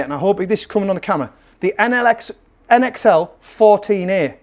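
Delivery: ordinary delivery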